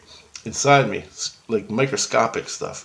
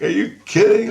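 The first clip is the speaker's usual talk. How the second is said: high, loud retold disbelief; incredulous; 14 semitones higher, 9 dB louder than usual. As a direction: pitch leaps up in amazed protest. Retelling disbelief at eleven million years.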